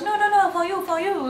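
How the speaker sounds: woman refuses to take it back; insistent woman. Retold or imitated. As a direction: insist she takes it. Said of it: "She goes".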